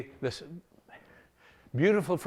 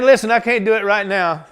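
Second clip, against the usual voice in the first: louder, higher firm promise; determined vow; 3 semitones higher, 7 dB louder than usual. Acted. David vowing to the Jebusites he will return. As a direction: promise it steadily.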